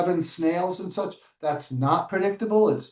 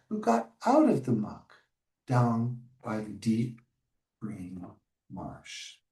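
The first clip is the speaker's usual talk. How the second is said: dropping lower and softer to a hush; fading story close; 7 semitones lower, 6 dB quieter, slower than usual. A narrator's reading. Teacher reading to children, fading to a hush. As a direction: let the ending sink away to nothing.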